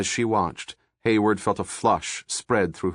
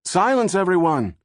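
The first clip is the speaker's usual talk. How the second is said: calling for silence; 8 semitones higher than usual. A narrator's reading. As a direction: shout the crowd down. Crowd hushes everyone.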